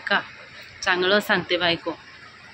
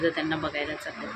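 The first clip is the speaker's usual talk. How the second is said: normal talking voice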